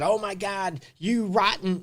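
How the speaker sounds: hammed-up angry name-calling. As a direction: fling the insult like a petty jab. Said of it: "and you start calling names".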